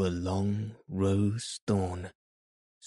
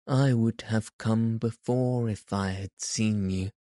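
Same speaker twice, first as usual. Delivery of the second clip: plain reading voice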